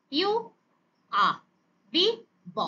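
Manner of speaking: name ইউ, pause, give its sound আ; each letter name answered by its sound, বি then ব. Shown as a demonstration. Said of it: "আ sound produce করে". (অক্ষরের নাম ইউ, থেমে তার ধ্বনি আ)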